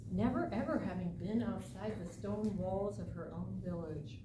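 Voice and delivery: ordinary delivery, nothing marked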